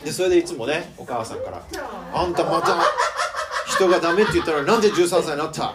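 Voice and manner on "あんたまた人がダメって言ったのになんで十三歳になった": loud mock scolding, laughing; hammed-up telling-off of a teenager; scolding mother. Pastor imitating a mother scolding her daughter, mock anger. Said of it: "って言ってですね".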